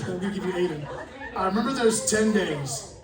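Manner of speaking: ordinary talk, nothing marked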